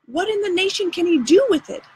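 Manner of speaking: can't believe the idea; exasperated question. A narrator's reading. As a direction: Huck exasperated with Tom's plan. Huck baffled about the rope ladder.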